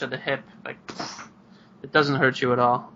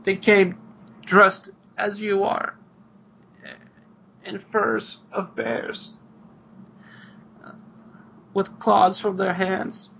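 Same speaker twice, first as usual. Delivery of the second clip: choked up; 7 semitones higher than usual. Acted. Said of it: "sniffles and gets all choked up".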